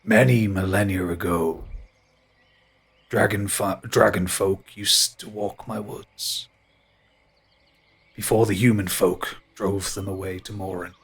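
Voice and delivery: low slow ancient tree voice. Acted. GM holds tree voice.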